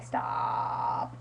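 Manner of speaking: low, drawn-out croak of "stop"; mock-dying, voiceless groan; exasperated plea. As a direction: drag it out softly, with no voice left. Acting out wish that she stop talking.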